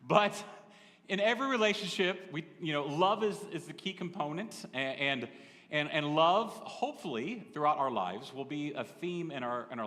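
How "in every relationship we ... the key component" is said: normal talking voice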